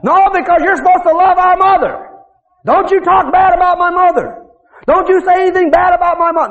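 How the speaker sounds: high, loud scolding; a furious woman's high voice; angry scolding woman. Retold or imitated. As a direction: scold him for insulting your mother. Woman berates Bill, slapping.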